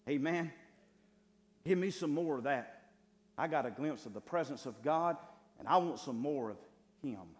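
loud, insistent sermon delivery; fervent preaching. Acted. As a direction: preach it out with force and hunger. Preaches with rising force.

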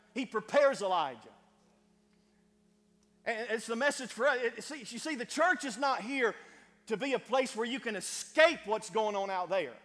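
loud, high, driving sermon climax; full-force preaching. Acted. Pastor urging church to go out, loud preaching. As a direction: preach it out, building to the congregation.

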